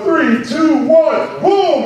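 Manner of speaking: buzzer-beater countdown, pitch climbing; voiced countdown. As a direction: count down with rising excitement. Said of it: "the clock started going".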